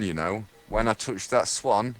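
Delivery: ordinary delivery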